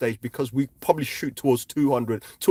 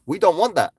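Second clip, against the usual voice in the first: emphatic; 5 semitones higher, 3 dB louder than usual. Real, not acted. Insisting on a bad outcome.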